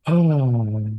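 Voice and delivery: loud high sigh of relief; relieved oh. Pretend. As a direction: let it all out in one relieved "oh". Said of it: "listeners on this show going like this".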